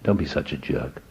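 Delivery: soft, hushed inner voice; inner little whisper. Retold or imitated. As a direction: murmur it like a voice inside your head. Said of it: "went off like a little whisper".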